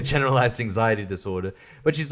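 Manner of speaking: normal talking voice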